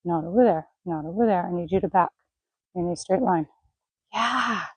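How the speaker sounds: ordinary delivery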